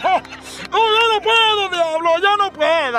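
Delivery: high-pitched hammed-up wailing; mock crying. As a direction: sob theatrically as you give up. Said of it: "sale gritando el güey con las ropas todas rasgadas y llorando".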